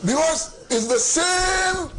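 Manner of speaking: slow, high, drawn-out insistence; emphatic shout. Real, not acted. Commentator insisting the sheets are identical, emphatic shout.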